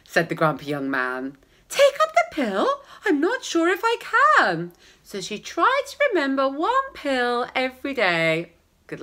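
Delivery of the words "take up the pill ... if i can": high incredulous retort; hammed-up outraged wife; indignant woman. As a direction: echo it up high in disbelief.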